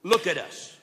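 a commanding apostle, lightly voiced; Peter's command. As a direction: call the beggar's eyes up to you. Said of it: "Peter said".

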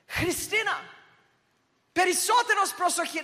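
imitated mum yelling a high, urgent warning in Greek; mother's warning yell. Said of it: "my mum would, like, yell".

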